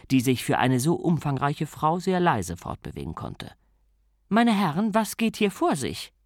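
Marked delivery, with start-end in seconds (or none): 4.3-6.1 higher, commanding lady of the house; a grand lady demanding answers; Lady Sybil voice. Acted.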